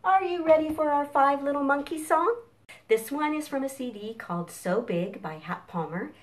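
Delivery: ordinary delivery, nothing marked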